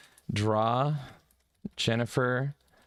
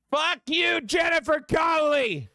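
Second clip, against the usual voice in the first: high shout; 4 dB louder than usual. Real, not acted. Streamer yelling the typed insult at the game, very high and loud. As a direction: yell "Fuck you, Jennifer Connelly!" very high and loud.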